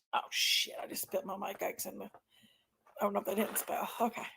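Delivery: whispered under the breath. Real, not acted. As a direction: hush it like a small oops. Spilled candy, muttering.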